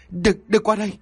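high, panicked warding off; wife pointing at an empty corner, screaming in fear; terrified. Acted. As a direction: push the ghost away with your voice. Wife screams at unseen ghost.

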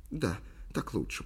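soft, hushed assent; whisper. A narrator's reading. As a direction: murmur it very softly. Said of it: "прошептала Ли". (тихий шёпот)

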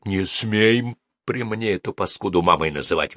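usual speaking voice

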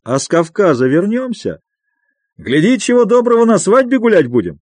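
loud, high hearty bluster; boisterous merriment. A narrator's reading. Friend cheering up his companion with Caucasus plans, boisterously merry. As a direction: crow it jovially with stretched words. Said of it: "захохотал Михаил Аверьяныч".

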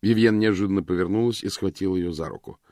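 ordinary delivery

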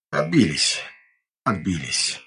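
panting after the battle; gasping, worn-out repeat; out of breath. A narrator's reading. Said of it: "тяжело запыхавшись". (запыхавшись, тяжело дыша)

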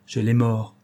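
usual speaking voice

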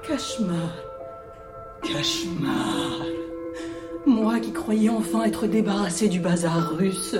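low, weary grumbling; low-pitched, long-suffering complaint; weary lament. Acted. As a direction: groan about being disturbed. Woken woman laments the returning nuisance.

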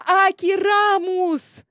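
high, loud, slow spell call; spell incantation. Acted. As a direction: cry out the magic word triumphantly. Casting a spell.

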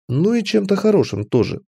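ordinary delivery, nothing marked

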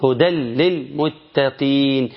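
slow chant of "هدى للمتقين" as "hudal-lil-muttaqin"; doubled L. Shown as a demonstration. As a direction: tanween of "هدى" merged fully into L. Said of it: "شيل التنوين وحط لام". (ترتيل "هدى للمتقين" بإدغام التنوين في اللام)

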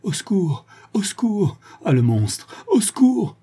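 cri aigu d'épouvante, bien plus haut; appel au secours terrifié. A narrator's reading. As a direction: hurler de terreur à la fenêtre. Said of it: "qui haletait". (appel de détresse très aigu, haletant)

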